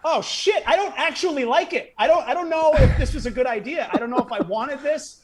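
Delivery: high-pitched nervous laughing panic; retold kid suddenly doubting it all; panicked regret. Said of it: "And I'm like".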